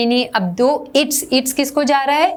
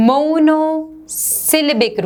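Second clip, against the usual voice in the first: "monosyllabic" stretched very slowly, raised pitch; drawn-out term; 5 semitones higher, much slower than usual. Shown as a demonstration. Teacher introducing a term to students, slow stretched "monosyllabic". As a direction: draw out "monosyllabic" part by part.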